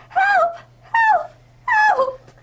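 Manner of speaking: very high, loud desperate screams; screaming for help. Acted. Princess trapped by forest shadows, screaming for help. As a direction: shriek for help with everything you have.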